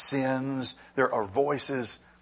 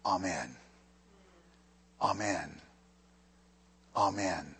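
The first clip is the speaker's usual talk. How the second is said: soft amen; 4 semitones lower, 5 dB quieter than usual. Shown as a demonstration. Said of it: "You might recognize this word".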